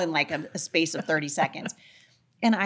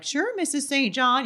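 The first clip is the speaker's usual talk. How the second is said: put-on high, slow sing-along politeness of kids; imitated kids humouring an adult; knowing fake agreement; 8 semitones higher than usual. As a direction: agree too sweetly, with a wink in it. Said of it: "wink, wink, nudge, nudge".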